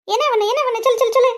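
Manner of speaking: high-pitched, rapid-fire urging; excitable, repetitive nagging; insistent pestering. Acted. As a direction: press for an answer in a high voice. Pestering a friend for an answer.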